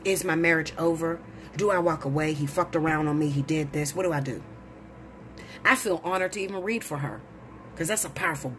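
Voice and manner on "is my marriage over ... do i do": a hint of a worried client, lower and softer; distressed client. Client in the chair asking [name] for help, lightly voiced. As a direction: voice her quiet heartbreak, low and small.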